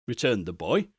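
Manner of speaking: ordinary delivery, nothing marked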